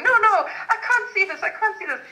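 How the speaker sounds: imitated Hungarian grandmother, high and distressed; Hungarian accent. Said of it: "in her Hungarian accent".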